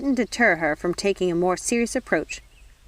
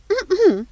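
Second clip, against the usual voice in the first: a loud, high, officious throat clear; 13 semitones higher, 6 dB louder than usual. Acted. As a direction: clear your throat before the indictment. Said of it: "She cleared her throat".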